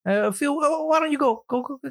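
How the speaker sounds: hint of a fussing Italian mama; Italian mother voice. A movie mother shooing Phil to the kitchen, mild Italian accent. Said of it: "you are now being canceled".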